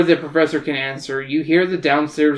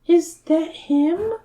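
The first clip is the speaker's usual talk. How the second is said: put-on very high girlish Mina voice; 13 semitones higher, much slower than usual. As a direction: squeak it out like a young girl.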